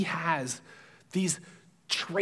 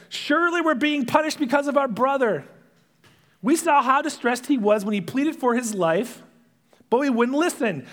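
mildly raised, higher and louder pleading; arguing brother speaking up; 8 semitones higher, 5 dB louder than usual. Retold or imitated. One brother to the others in prison, speaking up. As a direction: speak up over the argument, loud and high. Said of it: "one of them speaks up".

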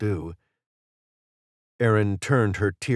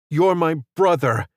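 high, loud screaming cry; 8 semitones higher, 5 dB louder than usual. A narrator's reading. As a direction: scream it right into his face. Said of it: "she screamed".

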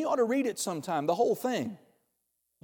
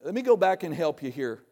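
normal talking voice

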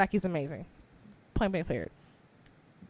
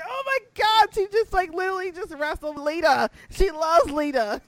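high, gushing fan excitement; squealing retold delight; giddy gushing; 7 semitones higher than usual. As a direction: gush like a fan whose idol just made it. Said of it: "I'm like".

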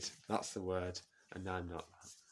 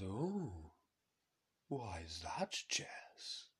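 Jacob Rees-Mogg; 4 dB quieter, much slower than usual. Acted. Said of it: "And Rees-Mogg says".

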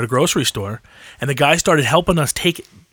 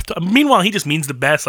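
ordinary delivery

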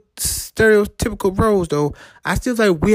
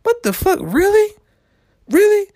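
higher-pitched, disbelieving outburst; incredulous self-talk; 11 semitones higher than usual. Retold or imitated. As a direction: ask it in rising disbelief. Acted disbelief in retold self-talk.

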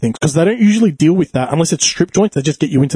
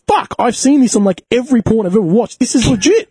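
shocked realization; 8 semitones higher than usual. Retold or imitated. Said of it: "that's where I thought".

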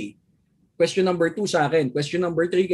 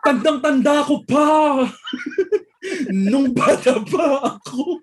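hammy dramatic voice; 6 semitones higher, slower than usual. Retold or imitated. Mock dramatic reminiscence.

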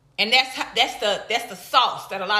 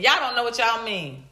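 ordinary delivery

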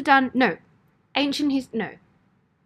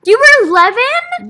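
surprised; 10 semitones higher, 12 dB louder, slower than usual. Real, not acted. Shocked reply.

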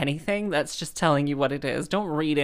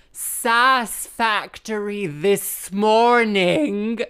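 very slow, loud, higher-pitched repeat; repeated for unhearing listener; 6 semitones higher, 6 dB louder, much slower than usual. Retold or imitated. Musician repeats her jibe to the vicar, loud and exaggerated. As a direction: stretch out every word loudly as if to someone hard of hearing. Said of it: "I didn't hear you".

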